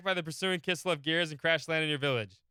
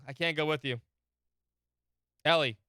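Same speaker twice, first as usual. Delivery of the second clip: usual speaking voice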